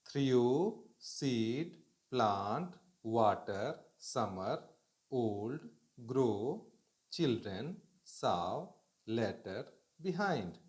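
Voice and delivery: word-by-word list reading, each word rising; reading aloud. Shown as a demonstration. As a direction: sound out every word clearly for the class. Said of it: "इन शब्दों को आपको जोर-जोर से बोलना".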